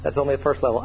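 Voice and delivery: ordinary delivery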